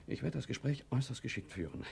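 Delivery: ordinary talk, nothing marked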